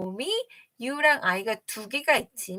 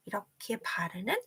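whispered aside; 7 semitones lower, 12 dB quieter than usual. Acted. Miming painting.

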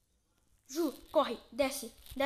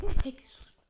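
loud laughing yelp of fright; laughing scare; 13 dB louder, slower than usual. Real, not acted.

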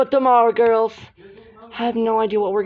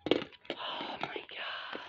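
slow breathy whisper; hushed aside; 19 dB quieter, much slower than usual. Real, not acted. Aside during doll quarrel.

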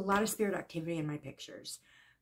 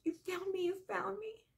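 imitated spirit's cry; 13 semitones higher, 4 dB quieter than usual. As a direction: relive her scream as a thin, high, tearful call. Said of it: "I heard Oakley screaming in my head".

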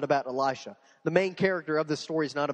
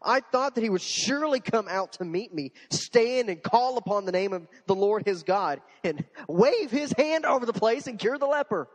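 higher, louder sarcastic huff; sarcastic frustration; 6 semitones higher, 5 dB louder than usual. Acted. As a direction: sneer it, fed up. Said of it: "hear the sarcasm and frustration".

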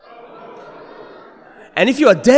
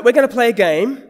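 ordinary talk

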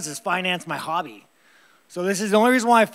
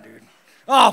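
ordinary talk

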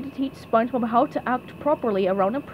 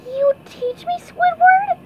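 very high squeal of wonder, growing louder; SpongeBob; 6 dB louder, slower than usual. Acted. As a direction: ask it awestruck, in a pipsqueak voice. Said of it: "SpongeBob's eyes grew big".

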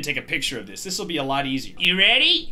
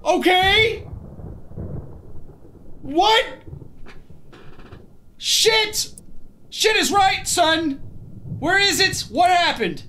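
shaken; 10 semitones higher, slower than usual. Real, not acted. Shaken after scare.

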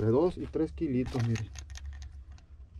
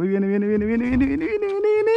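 rising excitement; 9 semitones higher, 3 dB louder than usual. Real, not acted. Fisherman chants viene ever higher as the fish surfaces. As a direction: pitch climbing higher with each viene.

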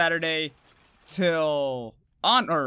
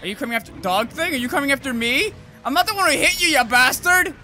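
higher, louder frantic yells; panicked yelling; 9 semitones higher, 5 dB louder than usual. Real, not acted. Streamer fleeing a second monster, frantic loud yelling. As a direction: yell in panic as it chases you.

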